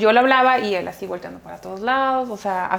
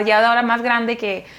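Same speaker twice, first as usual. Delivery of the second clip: ordinary delivery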